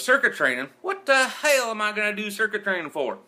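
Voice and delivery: loud, scoffing Southern voice, pitched high; mock the idea in a thick Southern accent. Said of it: "he goes".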